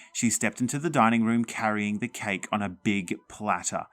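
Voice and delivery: plain reading voice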